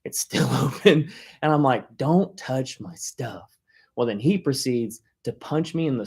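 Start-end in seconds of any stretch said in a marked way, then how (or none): 2.0-3.6 drawn-out, every word spaced; slow menacing warning. Retold or imitated. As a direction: lay down the law slowly. Retold warning to brother.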